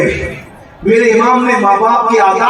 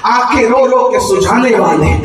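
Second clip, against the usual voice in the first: ordinary talk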